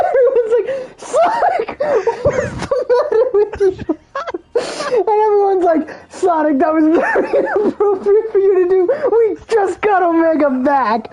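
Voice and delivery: high, giggly mock scolding; laughing through words. Real, not acted. Partygoers scold Sonic over Omega, voiced through laughter. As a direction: scold while cracking up for real.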